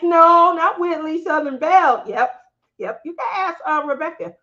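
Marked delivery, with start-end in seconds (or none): 0.0-2.0 quoting Cindy. Retold or imitated. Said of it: "Cindy said".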